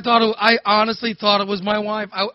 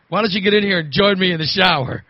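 ordinary talk, nothing marked

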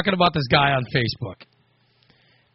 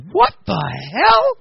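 higher, drawn-out mock outrage; wife's shocked outburst; 9 semitones higher, slower than usual. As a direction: imitate the wife reading a shocking text, slowed. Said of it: "she gets a text and she goes".

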